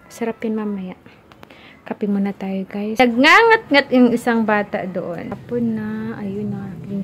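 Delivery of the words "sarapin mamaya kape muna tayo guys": whispered aside to the camera. Real, not acted. Mom whispering to her vlog viewers about later. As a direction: breathe it without voice, conspiratorial.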